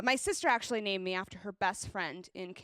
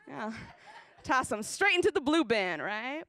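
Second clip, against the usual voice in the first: usual speaking voice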